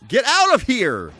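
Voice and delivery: ordinary talk, nothing marked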